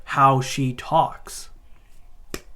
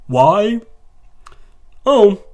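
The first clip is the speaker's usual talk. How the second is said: mocking; 6 semitones higher, 8 dB louder than usual. Mock exaggerated question.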